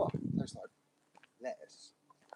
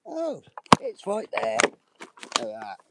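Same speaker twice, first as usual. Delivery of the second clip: found it; 14 dB louder, slower than usual. Real, not acted. Finds the bottle.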